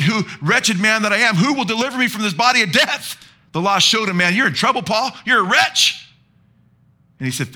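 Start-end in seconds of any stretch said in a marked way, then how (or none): 0.0-3.2 high, rising dramatic plea; preacher's impassioned quote, building in pitch; anguished cry. Acted. Preacher acts Paul's desperate cry. 4.2-6.1 raised, high-pitched rebuke; preacher voicing a forceful verdict; stern accusation. Law voiced accusing Paul.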